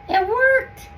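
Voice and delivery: triumphant little cry; thrilled. Real, not acted. Jar finally fits, thrilled.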